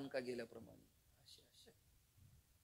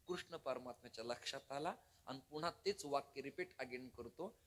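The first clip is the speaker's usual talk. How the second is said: ordinary talk, nothing marked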